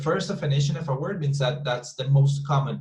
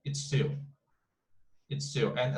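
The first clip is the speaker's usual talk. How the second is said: ordinary talk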